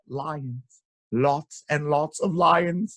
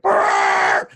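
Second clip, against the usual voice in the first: loud, lip-buzzed blare; elephant trumpet; 13 semitones lower, 10 dB louder than usual. A narrator's reading. Elephants fleeing from mice, loud trumpeting. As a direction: trumpet in alarm through buzzing lips. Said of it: "all the elephants went".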